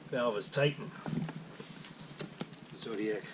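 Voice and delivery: normal talking voice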